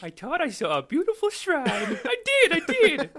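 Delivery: high mock-innocent squeal breaking into laughter. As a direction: plead naively, way up high. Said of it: "it's just the image of him, like".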